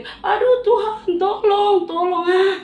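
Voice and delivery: high, loud, slow cry for help; desperate disciples pleading; frightened plea. Acted. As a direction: beg for rescue in panic. Disciples crying out in fear.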